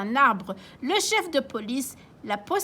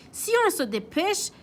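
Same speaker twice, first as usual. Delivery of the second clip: grandpa answering; 8 semitones higher than usual. Acted. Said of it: "répond grand-père Léo".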